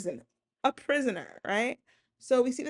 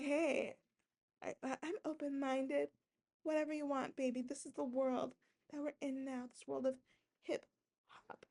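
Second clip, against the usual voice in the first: soft seductive; 4 semitones higher, 9 dB quieter than usual. Retold or imitated. Voicing herself open-minded.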